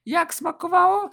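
high falsetto woman's question; Kasia's voice. A narrator's reading. Kasia asking her guest about the meal, high falsetto. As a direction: ask it in a high put-on female voice. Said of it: "spytała".

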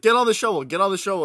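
high, loud urging to the frogs; pleading with frogs. Retold or imitated. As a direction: coax the frogs onto the shovel, loud and high. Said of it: "I was like".

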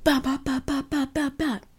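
high, rapid mock punch noises; punching sounds. Punching a fluffy chair to let out rage, bopping high. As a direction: pop each bop like a fist landing. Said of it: "started punching".

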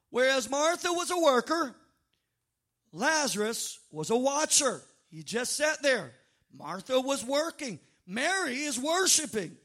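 ordinary delivery

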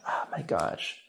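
breathy whisper under the breath; whispered aside. Real, not acted. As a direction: whisper it in disbelief. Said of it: "exasperated aside".

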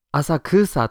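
浅草 said the American way, stress on KU; English-style pronunciation. Retold or imitated. Teacher recalling how the guest said it, Americanized 浅草. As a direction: lean on the second-to-last syllable, a-sa-KU-sa for 浅草. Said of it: "how Andy pronounced Asakusa".

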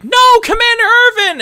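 very high, loud mock scream; hammed-up desperate shout; alarmed cry. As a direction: cry out in horror for your commander. Acts out soldiers crying out.

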